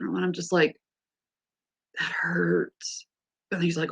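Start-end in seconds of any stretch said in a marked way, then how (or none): 2.0-3.1 whispered, low complaint of pain; mock-pained whisper. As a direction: act out a hushed reaction to being prodded. Acts out pain during joint exam.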